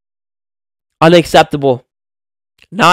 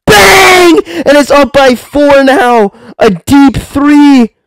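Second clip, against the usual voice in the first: ecstatic shout; 10 semitones higher, 4 dB louder, much slower than usual. Real, not acted. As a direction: scream with joy and stretch every word. Deep three extends the lead.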